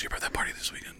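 hushed, quick whisper; super nervous. Retold or imitated. Agent sidling up to comic before the show, nervous whisper. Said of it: "super nervous".

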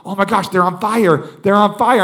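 freaking out. Retold or imitated. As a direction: act out a man freaking out. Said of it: "like, freaking out".

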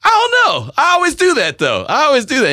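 high, loud mock protest. Host retells defending himself in a high loud voice. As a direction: squeal the excuse up high. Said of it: "And I was like".

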